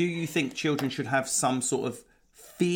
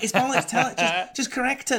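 much higher, louder, hammed-up no-nonsense nanny; mock bossy Lindsay; 12 semitones higher, 3 dB louder than usual. Husband mocking his wife's blunt Super Nanny style of discipline. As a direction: bark it out like a strict TV nanny, played for laughs. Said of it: "like doing Super Nanny".

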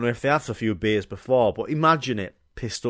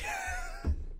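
high squeal laugh; 11 dB quieter than usual. Real, not acted. Laugh after the shout.

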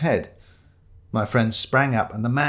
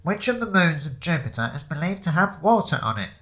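high-pitched voice over the pub's microphone; amplified quizmaster; 6 semitones higher than usual. Acted. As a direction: read the question out over a PA. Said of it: "said the amplified voice".

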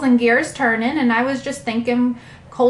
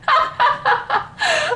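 high cackle. Real, not acted. Laughing at her own story.

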